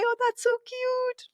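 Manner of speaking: put-on affectionate baby-talk coo; cooing at a child. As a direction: coo it like talking to a little kid. Cooing over a child's antics.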